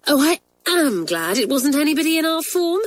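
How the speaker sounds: high glad relief; relieved exclamation. Acted. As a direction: let the relief lift it.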